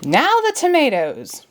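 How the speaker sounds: high bossy order; commanding queen. A narrator's reading. The Queen orders Alice around, bossy. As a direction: order her about. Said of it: "commanded the Queen".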